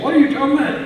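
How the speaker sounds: high, loud puzzled buddy voice; squeaky-high bewildered friend; baffled buddy. Retold or imitated. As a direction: play the confused fishing buddy.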